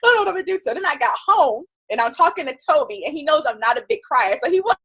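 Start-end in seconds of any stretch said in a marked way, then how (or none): 0.0-0.6 upset. Retold or imitated. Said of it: "you just start saying crazy stuff".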